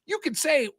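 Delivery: stammer, then insist it's on tape; exasperated retort. Retold or imitated. Retorting to a debate opponent.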